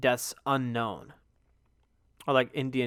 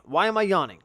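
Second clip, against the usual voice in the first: ordinary talk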